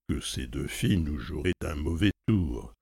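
ordinary delivery

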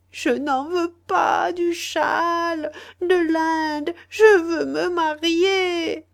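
high, slow tearful pleading; sobbing plea. Acted. Sister begs her family through sobs, high and slow. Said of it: "suppliait d'une voix sourde, étouffée".

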